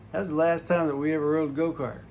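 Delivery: usual speaking voice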